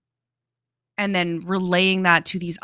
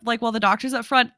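ordinary delivery

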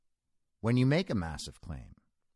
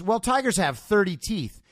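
louder, higher lecturing retort; mock know-it-all, dropping in pitch at the end; know-it-all sceptic; 5 semitones higher, 4 dB louder than usual. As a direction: correct them like a smug pedant. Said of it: "And he goes".

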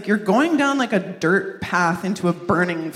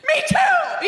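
high, laughing reply; a giggly mom's high squeak. Retold or imitated.

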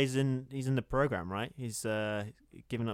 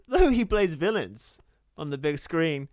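news read; 7 semitones higher, 7 dB louder than usual. Acted. Redoing the news line.

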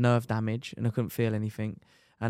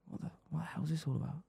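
fast, whispered disbelief; hushed shocked thought; 5 semitones higher, 15 dB quieter, much faster than usual. Retold or imitated. Rider waking after surgery and seeing the wires, whispering in shock. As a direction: mutter it to yourself, fast and hushed.

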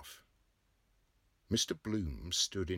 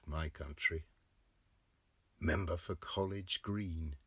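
plain reading voice

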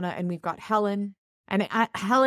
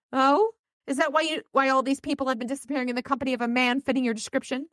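sarcastic accusation; 9 semitones higher, faster than usual. Acted. Priscilla accuses Dresden.

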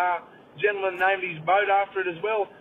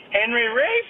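high, loud masseuse voice; masseuse's question; 7 semitones higher, 5 dB louder, slower than usual. Retold or imitated. Masseuse asking the client on the table, high mock voice. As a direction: ask it high with a rising end. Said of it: "she says".

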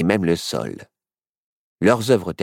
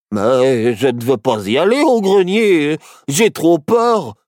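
whiny, drawn-out protest; reluctant, stretched-out fearful plea; scared whining; 6 semitones higher, 5 dB louder than usual. Acted. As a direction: whine like a frightened child. Child scared of the attic.

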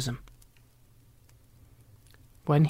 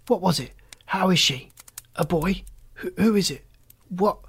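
higher, tumbling anxious questions; breathless rush; 4 semitones higher than usual. A narrator's reading. Anxious father rushing questions at the doctor, breathless. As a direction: blurt it out all in a rush. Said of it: "as he came up in a gasping rush".

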